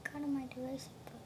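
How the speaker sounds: fast, breathy whisper; whispered confession. Real, not acted. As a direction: whisper it quickly, sheepish. Repeats confession softly.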